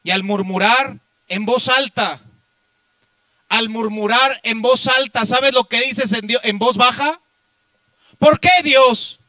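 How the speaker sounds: loud, high pulpit cry; impassioned preacher at full volume; full-force preaching. Real, not acted. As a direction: hurl it at the congregation as an accusation.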